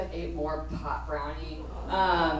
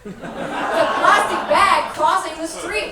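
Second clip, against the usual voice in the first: high, loud laughing scorn; laughing mockery; 9 semitones higher, 8 dB louder than usual. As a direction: crack up while mocking. Friend laughs at plastic bag.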